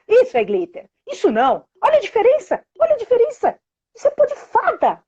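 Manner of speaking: high, animated make-believe pitch; playful showing. Retold or imitated. Mother showing daughter the difference, excited. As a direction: sell the magic with wide-eyed delight.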